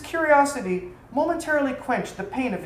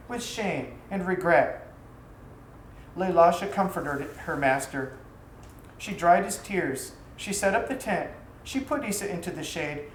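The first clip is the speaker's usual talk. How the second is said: usual speaking voice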